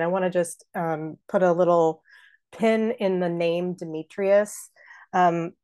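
ordinary talk

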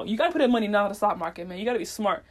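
ordinary talk

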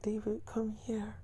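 slow, imitated come-hither whisper; hushed, acted seductive call; coaxing whisper. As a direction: whisper it slowly, luring someone over. Acting partner's whispered coaxing.

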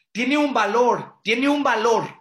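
slow and loud, leaning on valor; emphatic repetition. Real, not acted. Teacher stressing to students, loud and deliberate.

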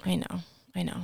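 breathy whispered agreement. Real, not acted. Co-host agrees about Nick in a whisper. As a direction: whisper it like a secret.

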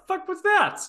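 retelling her stunned reaction to the rude lady; shocked disbelief. Retold or imitated. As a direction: voice jumps up in disbelief. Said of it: "And I was like".

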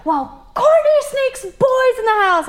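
high, slow whiny tattletale voice; mocking bratty sister. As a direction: whine it like a snitching little sister. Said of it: "Madison's like".

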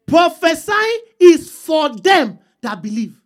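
slow, punched "Prophesying is for them"; emphatic. Shown as a demonstration. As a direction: preach it with "is" and "them" hit hard. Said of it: "why I'm emphasizing this".

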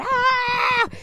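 high, drawn-out "aah"; imitated women's scream. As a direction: mimic others starting to scream. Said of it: "Empezaron a gritar".

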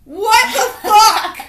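a high, loud, drawn-out laughing gasp of disbelief; shocked laugh. Real, not acted. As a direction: blurt it out slowly through a laugh, half horrified. Shocked at the back-hair image.

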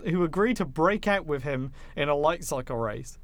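normal talking voice

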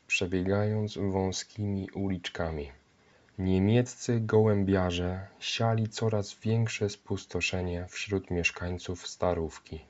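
plain reading voice

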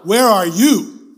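loud, slow and drawn out; loud call. Shown as a demonstration. Preacher leading the congregation to repeat after him, slow loud call. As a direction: call it slowly to a crowd to echo.

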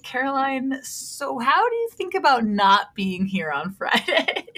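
imitated sheepish, wheedling ask. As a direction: gingerly broach an awkward favor. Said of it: "very sheepishly was like".

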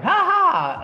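high, loud, nervous fake laugh; awkward laugh. Telling his wife about the coaching ask, awkward laugh. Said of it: "I'm kinda like".

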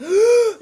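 loud, high gasping inhale; sharp gasp. Retold or imitated. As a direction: gulp in air like lungs opening. Imitating revived man's breath.

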